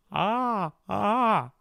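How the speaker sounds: high, bright twangy "ah"; twangy sound. Shown as a demonstration. Vocal coach demonstrating a twangy sound. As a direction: pinch the ah into a twang. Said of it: "this more twangy sound".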